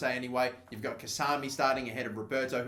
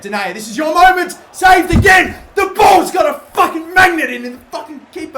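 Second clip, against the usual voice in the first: much higher and louder, yelling at the screen; frustrated shout; 13 semitones higher, 16 dB louder than usual. Real, not acted. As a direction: scream as the save goes in. Missed chance, yelling at game.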